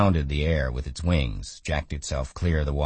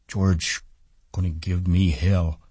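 put-on lightly raised, simple childlike voice; Lennie, childlike man; 3 semitones higher than usual. A narrator's reading. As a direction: speak like a simple, worried big man.

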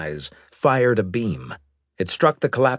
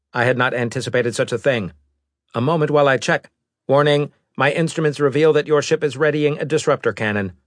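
fast, cold robot voice; a hint of a machine envoy; lightly voiced robot; 6 semitones higher, 4 dB louder, faster than usual.

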